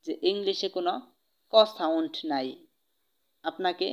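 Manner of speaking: ordinary talk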